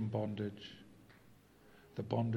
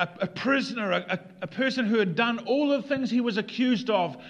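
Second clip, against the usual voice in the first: raised, high-pitched sermon delivery; impassioned preaching; 11 semitones higher, 9 dB louder than usual. Real, not acted. Pastor describes the thief's agony, impassioned preaching. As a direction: drive each phrase home at full force.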